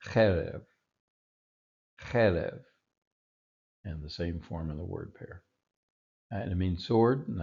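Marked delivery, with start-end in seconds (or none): 0.0-0.6 cherev with CHE stressed, falling after; accent the "che" of "cherev"; "cherev" leaning on its first syllable. Shown as a demonstration. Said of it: "that's gonna be on the first syllable". 2.0-2.6 cherev with CHE stressed, falling after; accent the "che" of "cherev"; "cherev" leaning on its first syllable. Shown as a demonstration. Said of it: "that's gonna be on the first syllable".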